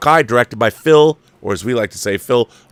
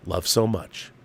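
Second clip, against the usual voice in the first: usual speaking voice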